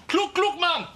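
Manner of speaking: high Geordie clucking, mock chicken. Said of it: "clucking in a Geordie accent".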